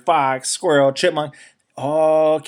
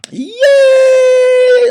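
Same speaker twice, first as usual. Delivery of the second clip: very high, loud squealing cheer; a big happy cheer; animals cheering; 10 dB louder than usual. Acted. Forest animals cheering for the bears, loud high cheer. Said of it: "All the animals cheered".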